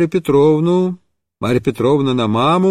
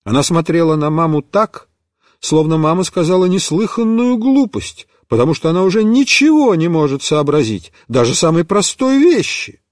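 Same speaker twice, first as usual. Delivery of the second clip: ordinary delivery, nothing marked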